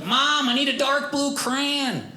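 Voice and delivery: put-on high, slow, drawn-out kid's call; yelling to mom. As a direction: imitate a child calling across the house. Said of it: "I would just yell to my mom".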